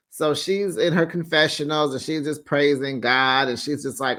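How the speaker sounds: ordinary talk, nothing marked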